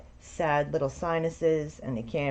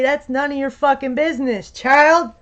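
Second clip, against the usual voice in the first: loud, high, exasperated yell; mock shout; 9 semitones higher, 10 dB louder than usual. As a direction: blast it at the nosy kid. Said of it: "you say".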